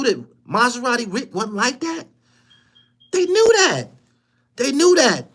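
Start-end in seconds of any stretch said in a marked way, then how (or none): 3.1-5.3 heated shout. Real, not acted. Ranting about Detroit crews.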